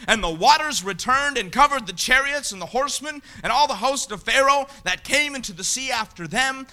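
ordinary talk

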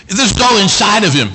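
acted outburst building to a high shout; the psalmist's bottled-up worry bursting out; full-force preaching. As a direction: preach it up into a yell.

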